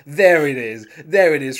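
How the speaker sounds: loud, triumphant boast; Job boasting. Retold or imitated. Uncle to nephew after getting his yes, loudly boasting. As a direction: crow it loudly to nobody in particular. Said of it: "like, boasting to nobody".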